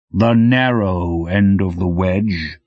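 slow, heavy voice of a mountain; rumbling mountain voice. Acted. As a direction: speak slowly, like a mountain talking. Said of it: "its voice about what you'd expect from a mountain".

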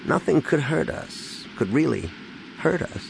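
strained grief, pausing before the last words; voice about to break. A narrator's reading. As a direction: push through it in broken phrases, pitch climbing. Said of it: "And then his voice broke up".

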